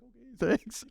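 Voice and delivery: high-pitched imitated awkward thanks; mock flustered, pitch way up; overwhelmed, unsure. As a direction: mimic a dad who can't handle the praise. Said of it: "didn't know how to handle it".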